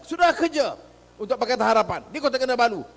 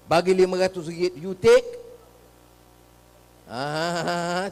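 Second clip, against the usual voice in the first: ordinary delivery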